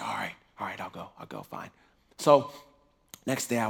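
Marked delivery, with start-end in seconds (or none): 0.0-1.7 much softer, lower, resigned; grudging give-in. Retold or imitated. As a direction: mumble the surrender. Reluctant giving in.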